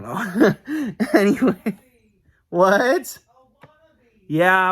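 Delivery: giggling high and loud; laughing at own faux Irish joke. Real, not acted. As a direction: laugh it off and brush on. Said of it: "laughs at own joke".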